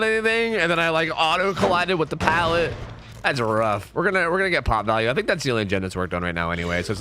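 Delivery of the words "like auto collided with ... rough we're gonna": ordinary talk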